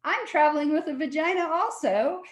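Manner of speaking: high, cheeky punchline read; lightly voiced stand-up comic line; mock comic retort. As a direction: toss it off like a stand-up joke. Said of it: "I remember the stand-up comic and I go".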